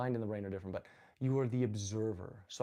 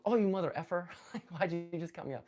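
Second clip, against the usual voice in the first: half-laughing mock outburst, starting high and loud; playful imitation of an irritated driver; mild road rage; 6 semitones higher, 3 dB louder, faster than usual. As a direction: grumble at a bad driver, not in earnest. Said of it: "get a little road ragey".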